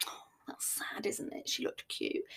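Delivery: hushed gossiping whisper; imagined pitying whisper. Pretend. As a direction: whisper it like staff pitying her across the bar. They didn't really say that.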